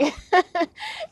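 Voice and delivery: short high laugh; high giggle. Real, not acted. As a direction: giggle before the story. Laughing retelling mom story.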